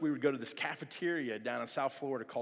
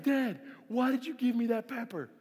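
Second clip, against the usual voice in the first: high-pitched, whiny mock outrage; high, aggrieved wail of accusation; mad kid brother; 8 semitones higher than usual. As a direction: imitate a hurt child angrily blaming Dad. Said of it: "now he's mad at my dad".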